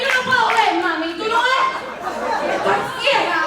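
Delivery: high, loud desperate Spanish plea; grito desesperado; panicked cry. Retold or imitated. Yelling at mom she's blind.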